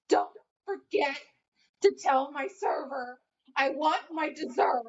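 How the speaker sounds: high-pitched imitation of a tearful customer; mock crying. Server imitating a crying customer calling to the host, mock crying. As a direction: mimic her wailing demand as she runs off. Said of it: "goes back to her table crying".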